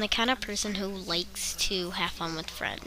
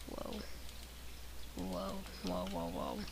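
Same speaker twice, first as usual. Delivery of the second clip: hushed whoa; 13 semitones lower, 16 dB quieter than usual. Acted. Shocked realization after marriage.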